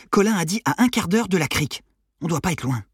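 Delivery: high-pitched, keen reckoning; eager teenage Armand. Acted. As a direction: lead the search like an excited teen. (ado impatient, sur une piste)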